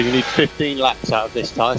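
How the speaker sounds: usual speaking voice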